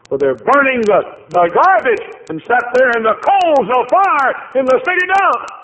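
high, loud preacher's cry; preaching at full force. Acted. Preacher crying out Job's losses to the congregation, full force. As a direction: shout the loss out over the pews.

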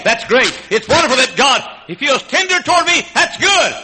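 high, loud, rejoicing delivery; joyful preaching. Acted. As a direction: celebrate each line to the crowd.

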